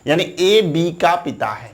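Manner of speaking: ordinary talk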